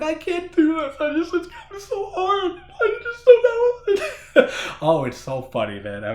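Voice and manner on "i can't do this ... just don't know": much higher, louder, laughing through fake sobs; mocking a husband sobbing to the girl; mock breakdown. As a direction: ham up a man crying and falling apart. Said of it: "he starts breaking down, crying".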